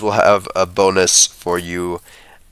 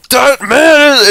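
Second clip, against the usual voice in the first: high, loud, slow accusation; indignant outburst; 6 dB louder, slower than usual. Acted. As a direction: start to denounce him again. NPC accusing again.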